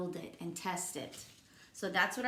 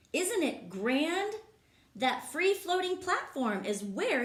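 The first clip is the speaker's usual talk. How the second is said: lively, sing-song read-aloud voice; reading to children; 4 semitones higher, 5 dB louder than usual. Acted. Teacher reading a rhyming book to kids, lively. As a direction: bounce the rhymes for the class.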